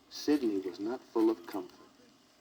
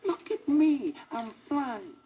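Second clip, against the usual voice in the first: delighted bird; 13 semitones higher, 4 dB louder, slower than usual. A narrator's reading.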